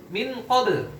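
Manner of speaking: "min qabl" with the N clearly sounded before the Q; idhar reading. Shown as a demonstration. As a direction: keep a distinct N in "min qabl". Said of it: "Kalau dibaca idhar ini gimana bacanya". ("min qabl" dibaca idhar, nun jelas)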